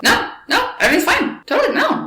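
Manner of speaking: very high-pitched, imitated brush-off; mock breezy denial. Fiancé brushing off her concern.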